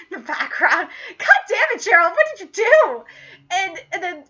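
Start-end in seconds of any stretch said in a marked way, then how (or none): 1.2-3.0 high, loud exasperated scolding; mock exasperation. Fan scolding Gerald over his mess, mock exasperated. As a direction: scold him in raised mock dismay.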